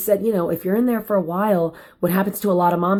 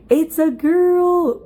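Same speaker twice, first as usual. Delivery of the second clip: slow, high, stretched-out amazement; delighted surprise; 10 semitones higher, 4 dB louder, much slower than usual. Real, not acted. Mom relives learning baby is a girl, high delighted surprise. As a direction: savor the news, high and slow. Gender reveal moment.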